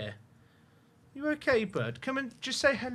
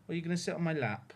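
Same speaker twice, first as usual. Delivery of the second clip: usual speaking voice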